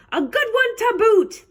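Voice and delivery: high pirate crew echo; crew agreeing. A narrator's reading. Pirate crew echoes the captain's praise, agreeing heartily. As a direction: chime in eagerly as the whole crew. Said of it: "the others agreed".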